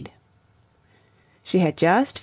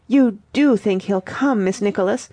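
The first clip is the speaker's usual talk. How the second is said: yearning; 2 semitones higher than usual. Acted. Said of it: "the yearning in his voice".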